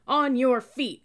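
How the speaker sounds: loud, high-pitched roared order; roaring. A narrator's reading. Cadet ordering new recruits to stand, roaring. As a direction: roar them up off their seats. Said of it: "he roared".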